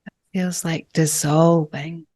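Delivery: calm, unhurried breathwork guide with long pauses; gentle meditation leader, phrase by phrase; guided meditation. Real, not acted. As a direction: ease them into each breath, leaving lots of space.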